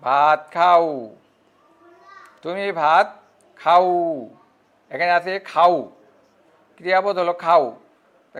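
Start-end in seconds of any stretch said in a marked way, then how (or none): 0.0-1.1 slow, খাও held long at the end; drawn-out ending. Shown as a demonstration. Teacher dictating an example, খাও drawn out. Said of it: "টানে উচ্চারণ করো". 2.4-4.3 slow, খাও held long at the end; drawn-out ending. Shown as a demonstration. Teacher dictating an example, খাও drawn out. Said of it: "টানে উচ্চারণ করো".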